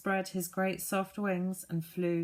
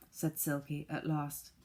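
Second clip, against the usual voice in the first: ordinary delivery, nothing marked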